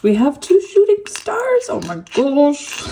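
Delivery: giddy joy, then a slow gasp; thrilled. Real, not acted. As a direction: gush over the charms. Charms show shooting stars.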